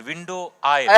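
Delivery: loud, curt and exasperated; fed-up officer. Retold or imitated. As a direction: bark the two words at the clueless traveller. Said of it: "He got mad".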